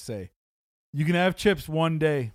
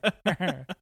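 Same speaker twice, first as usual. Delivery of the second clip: breathy giggle breaking out; can't hold the laugh in; helpless giggle; 3 semitones higher than usual. Real, not acted. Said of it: "Sorry, I'm losing it right here".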